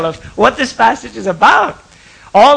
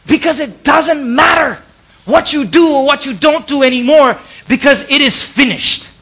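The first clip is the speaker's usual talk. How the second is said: preaching shout; 6 semitones higher, 3 dB louder than usual. Real, not acted.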